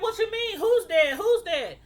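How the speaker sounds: high, loud cries of Who's dead; shrill alarm breaking in; panicked disbelief. Retold or imitated. Woman hears the girl is dead, panicked and loud.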